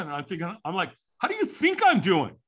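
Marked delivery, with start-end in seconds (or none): 1.2-2.4 much higher, exasperated snap; exasperated retort. Retold or imitated. To the early caller, exasperated much higher retort. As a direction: throw the question back at him. Grumpy reply to early call.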